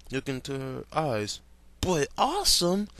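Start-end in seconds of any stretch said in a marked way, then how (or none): 1.8-2.9 put-on high, eager boyish voice; Stephen's voice. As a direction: blurt it like an excited kid. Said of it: "Stephen said".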